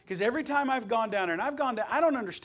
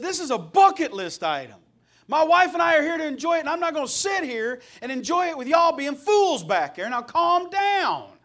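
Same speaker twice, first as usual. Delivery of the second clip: stern scolding; 9 semitones higher, 8 dB louder than usual. Retold or imitated. Scolding the rowdy people behind him at the concert, loud and firm. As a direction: lay down the law like a bouncer.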